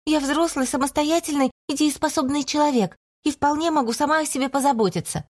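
high, indignant protest; indignant young woman. A narrator's reading. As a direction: insist on being grown-up, high voice. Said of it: "В порыве праведного возмущения". (возмущённая девушка)

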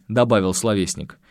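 ordinary delivery

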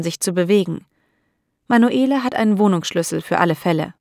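ordinary delivery, nothing marked

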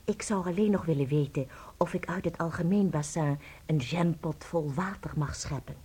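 whispered, secretive question; hushed. Acted. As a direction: ask it so nobody else can hear. Secret request to the nun. (fluisterend, heimelijk)

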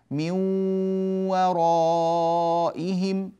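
من ورائهم chanted slowly, the nun merged into the waw with a nasal hum; slow recitation. Shown as a demonstration. Teacher reciting to show the nose working on waw. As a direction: hold the ghunna through من ورائهم. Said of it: "الأنف يعمل عند الواو كذلك".

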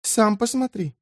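teasing soft reply. A narrator's reading. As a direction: answer coyly, softly. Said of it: "ответила она ему".

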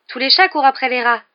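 heated defence in a quarrel; defensive. A narrator's reading. Hermione defending her cat in quarrel.

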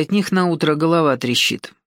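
ordinary delivery

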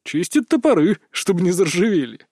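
ordinary delivery, nothing marked

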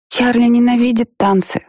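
put-on high childlike insistence; lightly voiced stubborn girl; little girl voice. A narrator's reading.

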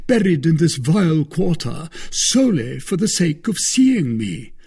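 a boastful musketeer, lifted high; Porthos voiced high. A narrator's reading.

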